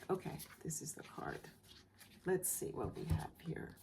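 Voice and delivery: soft unvoiced whisper; whispered reveal. Real, not acted. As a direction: hush it as the card is turned. Revealing a card.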